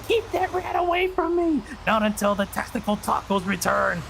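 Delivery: high-pitched yelling without much volume; mock panicked yell. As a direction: imitate a frightened shriek, held back. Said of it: "she was yelling".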